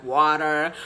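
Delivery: American "water" with the R rolled; American accent. Shown as a demonstration. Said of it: "the American way of pronouncing words".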